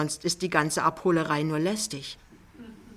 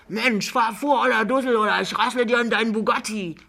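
loud, higher, rough yell at the driver; rough Berlin shout; 5 semitones higher, 6 dB louder than usual. A narrator's reading. Angry driver yells at the cab driver to move on, loud. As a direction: bark it at him across the rank.

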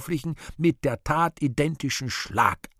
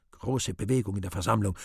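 ordinary delivery

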